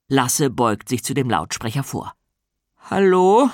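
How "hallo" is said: ordinary delivery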